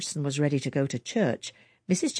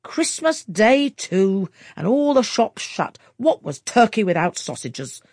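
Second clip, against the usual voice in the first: louder, slow, grumbling complaint; gruff; 5 semitones higher, 8 dB louder than usual. A narrator's reading. Husband complaining about the eaten Christmas sausages, gruff. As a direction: grumble it loud over the ruined dinner. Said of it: "gruffly asked Mister Jenkins".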